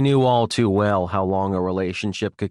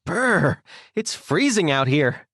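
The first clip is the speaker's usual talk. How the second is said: perky, higher-pitched chilly exclamation; a touch of shivering, pitched up; cheerful shiver; 7 semitones higher, slower than usual. A narrator's reading. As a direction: act lightly shivery but stay chipper. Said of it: "The cheerful guy shivered".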